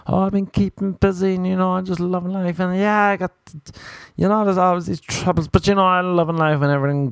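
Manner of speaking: high-pitched, put-on upbeat chatter; fake LA positivity. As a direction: gush insincerely about a busy life. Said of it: "they're so fake".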